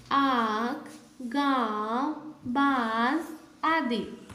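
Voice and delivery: आँख, गाँव, बाँस drawn out slowly with nasal vowels. Shown as a demonstration. Teacher to class, slow clear nasal vowels. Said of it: "चंद्र बिंदु".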